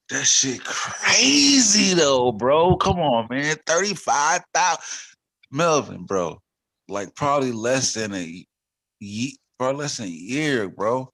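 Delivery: high, loud amazed rant; worked up and amazed; excited disbelief. Retold or imitated. Retold disbelief at Bitcoin price.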